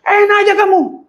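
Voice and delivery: high, loud indignant yell; loud and high, full of outrage; angry shout. Retold or imitated. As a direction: fire it back, offended. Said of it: "Wah, marah".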